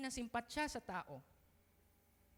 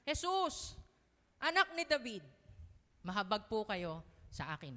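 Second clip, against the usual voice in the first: pleading cry; 5 dB louder than usual. Acted. Blind beggar calling out to Jesus, loud pleading cry. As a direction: call out to Jesus begging. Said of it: "sabi niya".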